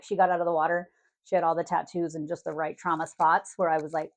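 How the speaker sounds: normal talking voice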